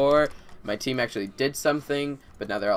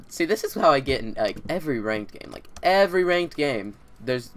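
usual speaking voice

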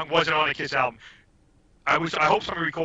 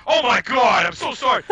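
higher, louder retold gasp of apology; mock-horrified sorry from a friend who nearly hit you; shocked apology; 8 semitones higher, 4 dB louder than usual. Joe realizing his mistake.

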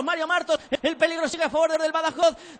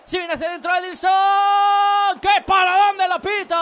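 excited save call; 5 semitones higher, 6 dB louder, slower than usual. Real, not acted. Big save, commentator shouts.

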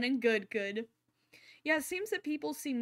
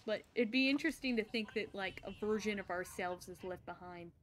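ordinary talk, nothing marked

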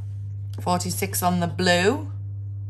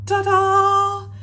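high, loud ta-da; a big showy reveal; excited ta-da; 13 semitones higher, 5 dB louder than usual. Real, not acted. Reveals scavenger hunt card.

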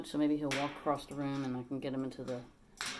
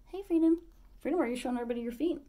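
high, louder call to the bird, fast; calling out to her parrot in a high voice; high pet voice; 9 semitones higher, 6 dB louder, much faster than usual. Real, not acted.

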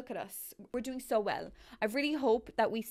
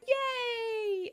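delighted gasp and cheer; 13 semitones higher than usual. Real, not acted. Gamer thrilled her Sims finally kiss, high squeal. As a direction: burst with joy at the kiss.

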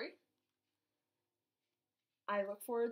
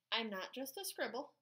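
ordinary delivery

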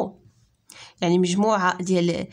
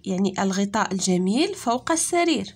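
ordinary delivery

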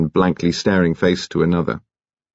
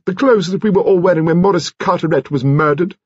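high-pitched grandmother's voice; high, demanding aristocratic lady; imperious old lady; 10 semitones higher than usual. A narrator's reading. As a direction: question him in a high grande-dame voice. Voices Lady Lacklander in story.

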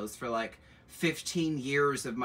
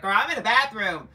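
imitating a friend; 9 semitones higher, 9 dB louder than usual. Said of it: "She's like".

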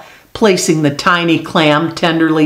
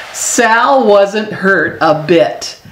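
usual speaking voice

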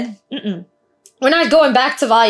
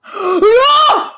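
gasp; 12 semitones higher, 4 dB louder than usual. Real, not acted. Watching a shocking scene.

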